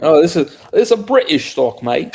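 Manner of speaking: hammed-up British accent, high and loud; bad British accent. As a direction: put on a terrible cockney-ish British voice. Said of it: "the worst British accent anyone can find online".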